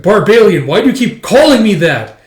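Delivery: high, loud angry question; retold quarrel outburst; acted exasperation. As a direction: demand an answer, fed up. Retold quarrel over his name.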